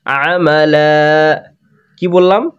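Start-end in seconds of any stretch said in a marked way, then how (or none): 0.0-1.4 আমালা with a long stretched first a; drawn-out amala. Shown as a demonstration. Teacher modelling আমালা with a long first vowel. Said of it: "তাহলে কি বলবো?".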